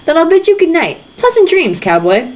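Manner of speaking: high, mock-formal butler voice; mock British butler. A narrator's reading. Reader voicing Wiesel as a mock butler. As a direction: bid him good night like a sneering butler. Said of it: "with mock formality as though he were a British butler".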